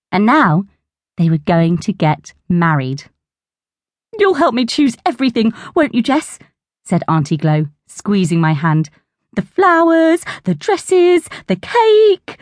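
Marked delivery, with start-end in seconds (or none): 4.2-6.4 eager aunt voice. A narrator's reading. 9.3-12.4 high and rising with glee; excited listing. A narrator's reading.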